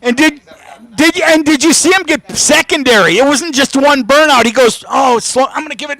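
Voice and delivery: high-pitched, animated recap; excited race commentary. Real, not acted. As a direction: relive the burnout, still fired up.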